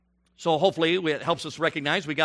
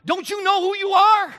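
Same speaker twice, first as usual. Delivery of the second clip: preaching climax refrain; fervent refrain; 13 semitones higher, 4 dB louder than usual. Real, not acted. Refrain to angry father.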